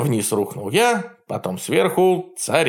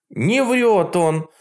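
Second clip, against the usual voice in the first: put-on defending girl; 8 semitones higher, much slower than usual. A narrator's reading. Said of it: "вступилась за меня Василиса".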